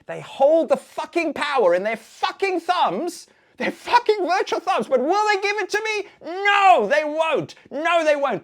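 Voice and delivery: loud, high shouted outrage; furious rant. Acted. Performed rant at withholding viewers.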